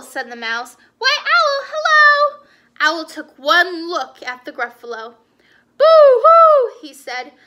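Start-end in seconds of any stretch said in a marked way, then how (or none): 1.0-2.5 high, loud mouse hello; high-pitched show-off mouse; chirpy mouse greeting. Acted. 5.8-6.8 high, very loud hooting wail; hammed-up owl cry; frightened owl.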